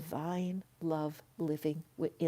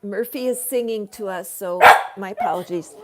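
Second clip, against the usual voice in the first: ordinary delivery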